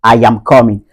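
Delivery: COMING as "KAW-min" in a Nigerian accent. Shown as a demonstration. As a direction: Nigerian English with rounded "aw" in coming. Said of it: "Du côté nigérian".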